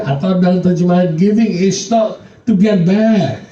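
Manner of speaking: high, loud, pressing sermon voice; full-force pulpit preaching; fervent preaching. Acted. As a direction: preach it up to the congregation with fire.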